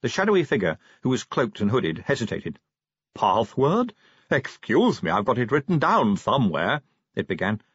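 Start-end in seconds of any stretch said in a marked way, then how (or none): 3.1-6.8 hesitant, lisping Igor voice; lisping henchman. A narrator's reading. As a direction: turn the s sounds into th. Lisping character answers password challenge.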